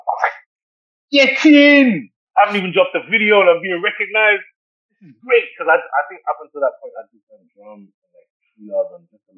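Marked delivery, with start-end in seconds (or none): 1.1-5.4 high, loud burst of "Get in!" easing slightly; reliving a triumphant cheer, pitched up high; elated celebration. Retold or imitated. Retold elation at first autograph request.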